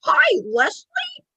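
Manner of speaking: very high, slow puppet greeting; drawn-out squeaky spirit hello; Tommy. Acted. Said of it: "And then Tommy goes".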